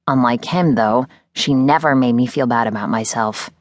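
ordinary delivery, nothing marked

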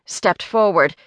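plain reading voice

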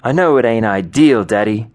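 usual speaking voice

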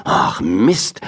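usual speaking voice

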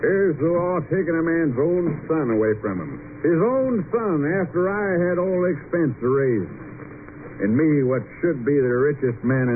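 put-on high, indignant drunken tirade; outraged father's harangue; aggrieved rant. Drunken father rants about the law.

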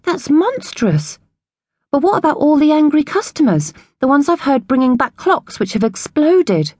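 outraged. Acted. Lily outraged at cruel clocks.

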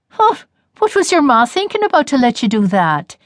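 a hint of granny indignation; indignant grandmother. A narrator's reading. Grandmother scolds.

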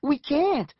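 high, alarmed cry; panicked protest. Acted. Julie answering Eric under attack, high panicked protest.

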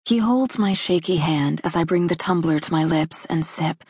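plain reading voice

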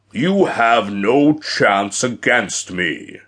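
slow, menacing giant's voice; threatening giant. A narrator's reading.

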